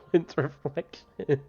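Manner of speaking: helpless laugh running into the words; real laughter. Real, not acted. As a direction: crack up loudly, then squeeze the words out slowly. Bursts out laughing at chat.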